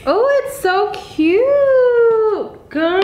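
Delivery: high, slow, gushing squeal; drawn-out delighted squeal; gushing excitement. Real, not acted. Final reveal, gushing.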